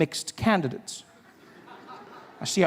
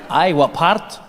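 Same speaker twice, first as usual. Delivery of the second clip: put-on Scottish accent from the shopkeeper; 3 dB louder, slower than usual. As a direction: question him back in a Scottish accent. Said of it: "They'd be like".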